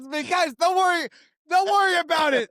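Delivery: high loud hammed-up reassurance; mock shout. Telling the guys not to worry, hammed-up shout. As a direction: yell it out like a jolly herald.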